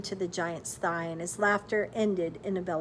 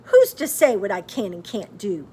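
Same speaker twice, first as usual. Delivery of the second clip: snapped; 10 dB louder than usual. A narrator's reading. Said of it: "Margaret snapped".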